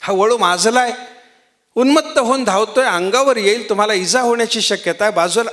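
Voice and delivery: villagers shouting a warning. Retold or imitated. Villagers shouting at the old man to step aside from the charging bull. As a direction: call out urgently to a deaf old man. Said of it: "ओरडून सांगितलं".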